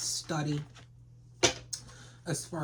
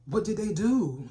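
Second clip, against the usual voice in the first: higher, louder astonished question; incredulous question; 6 semitones higher, 6 dB louder than usual. Acted. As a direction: burst out in disbelief, demanding to know. Mock astonishment at the gossip.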